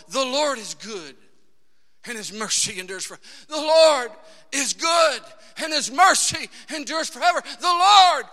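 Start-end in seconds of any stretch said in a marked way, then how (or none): 3.5-7.4 "The Lord is good" shouted louder and higher; loud, high repeat of "The Lord is good and his mercy endures forever"; shouted praise. Shown as a demonstration. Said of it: "repeating it over and over". 7.6-8.3 loud, rising shout; preacher shouting the praise again and again; preaching at full force. Acted. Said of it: "preaching at full force".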